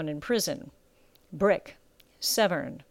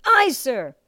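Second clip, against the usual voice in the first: high, loud answer; recruit's reply; 5 semitones higher, 5 dB louder than usual. A narrator's reading. Recruit confirming to drill master, loud shout.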